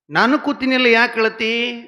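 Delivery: high, loud pleading and demanding; exasperated shout. Retold or imitated. Father loudly asking the crying boy what he wants.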